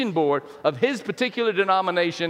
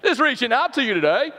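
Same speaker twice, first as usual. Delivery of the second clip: playful mock Australian greeting, louder and pitched up; hammed-up Australian lilt; Australian accent; 5 semitones higher, 4 dB louder than usual. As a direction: put on a cheery Aussie accent for fun. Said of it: "like, an Australian accent".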